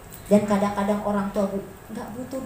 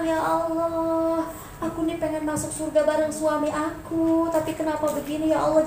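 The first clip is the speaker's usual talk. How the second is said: humble heartfelt prayer; 4 semitones higher, 4 dB quieter than usual. Shown as a demonstration. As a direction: pour your heart out gently. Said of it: "Ibu harus bilang".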